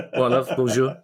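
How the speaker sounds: a Cockney bloke greeting you in French, South London accent. Shown as a demonstration. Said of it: "it depends if it's a South London accent".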